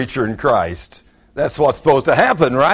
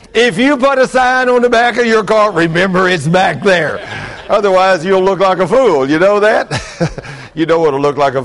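loud high preaching, pressing the point; fiery preacher warning; 7 semitones higher, 6 dB louder than usual. Acted. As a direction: call it out to the congregation. Preaching at full force.

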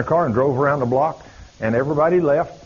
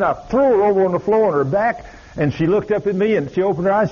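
ordinary talk

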